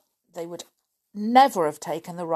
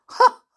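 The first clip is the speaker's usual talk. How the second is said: triumphant ha; 11 dB louder than usual. A narrator's reading. Triumphant laugh.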